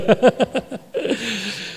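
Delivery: high, tickled chuckle; amused laugh. Real, not acted. Preacher amused after showing the dialect word, high chuckling. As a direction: break up after your own punchline.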